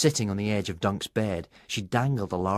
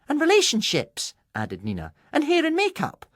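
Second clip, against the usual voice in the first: lightly voiced girl; 5 dB louder than usual. A narrator's reading. Girls voiced, high.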